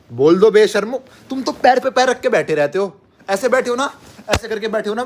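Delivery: loud, high scolding of the viewers; mock anger. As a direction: play-act a shouted telling-off. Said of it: "गुस्सा आ जाएगा मुझे".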